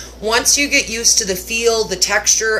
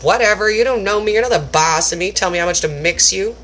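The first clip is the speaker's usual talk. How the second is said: ordinary delivery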